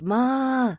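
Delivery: high loud call; calling out across the clubhouse; yelling. A narrator's reading. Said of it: "as I yelled".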